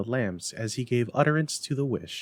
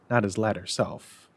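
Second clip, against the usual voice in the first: ordinary delivery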